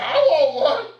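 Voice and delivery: very high, loud, laughing claim; excited retelling. Retold or imitated. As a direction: pipe up eagerly through a laugh. Retold eager claim, laughing.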